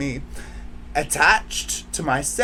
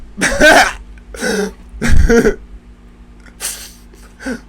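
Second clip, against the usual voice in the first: a loud, high laugh of pure delight; real burst of laughter; 8 semitones higher, 10 dB louder than usual. Real, not acted. Vlogger thinking of her partner, bursting into laughter. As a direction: laugh out loud at the thought of him.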